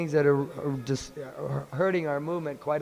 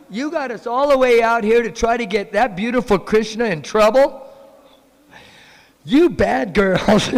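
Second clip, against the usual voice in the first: loud, higher mock scolding, slow at the end; scolding mothers; 6 semitones higher, 11 dB louder than usual.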